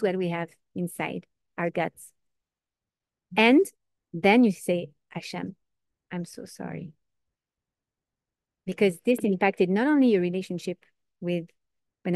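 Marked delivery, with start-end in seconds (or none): 5.1-6.9 softly, to Hashem, very quiet; hushed prayer. Acted. A penitent quietly apologising to Hashem in prayer. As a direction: breathe the apology to God.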